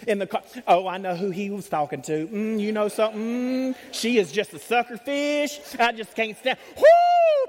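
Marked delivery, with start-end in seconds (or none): 0.7-6.6 gossiping churchgoer. Retold or imitated. Gossip in the car imitated. 6.8-7.5 a loud high whoop of delight. Real, not acted. Preacher whooping at the congregation, loud and high.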